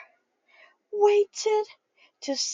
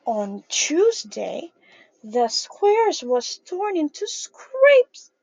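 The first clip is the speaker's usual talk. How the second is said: slow, weighty storybook line; slow dramatic storytelling; 3 semitones lower, 3 dB louder, slower than usual. A narrator's reading. Teacher telling kids the square got torn, slow dramatic read. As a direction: draw out the bad news for the kids.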